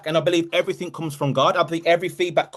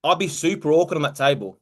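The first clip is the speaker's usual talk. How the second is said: normal talking voice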